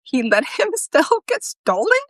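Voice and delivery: laughing in disbelief; can't hold back the laugh. Acted. Laughing at stolen intern.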